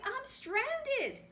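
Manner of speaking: very high, loud panicked shout; stranded frog yelling for help. Acted. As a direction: scream for rescue over the storm. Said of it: "he shouted".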